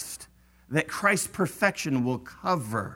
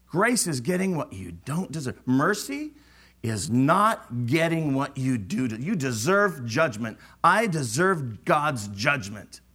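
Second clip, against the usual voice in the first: ordinary talk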